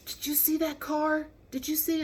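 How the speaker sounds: high, aghast questioning; shaken disbelief. Retold or imitated. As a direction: imitate a rattled examiner, pitched well up. Shaken examiner confronts the driver.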